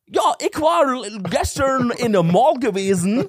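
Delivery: hoch, langsam, übertriebener britischer Akzent. Retold or imitated. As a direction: deutscher Typ mimt britischen Akzent. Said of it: "so einen U-K-Accent". (britischer Akzent)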